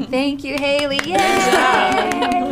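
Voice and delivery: sing-song praise for Haley after her talk; excited cheer. Real, not acted. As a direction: cheer her on, beaming with delight. Leader cheers the presenter.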